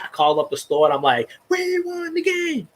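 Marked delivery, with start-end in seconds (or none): 1.5-2.7 mock gloat. Gloating to the store on the phone.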